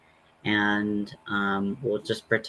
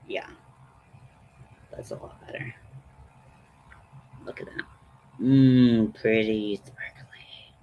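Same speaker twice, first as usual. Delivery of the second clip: whispering; 8 semitones lower than usual. Real, not acted. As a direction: whisper it close to the mic. Whispers while applying makeup.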